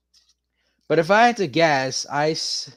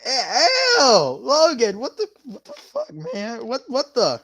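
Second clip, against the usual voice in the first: grossed out; 5 semitones higher, 3 dB louder than usual. Real, not acted. Reacting to chat's gross message.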